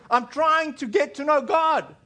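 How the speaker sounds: high, slow imitation of frustration; frustrated plea. Congregant struggling to find God tells the preacher, frustrated.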